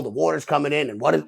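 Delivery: normal talking voice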